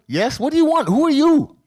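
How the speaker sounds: put-on mother irritably questioning the two young men in her office; impatient demand. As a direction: snap at two strangers who won't explain themselves. Mother confronted by strangers.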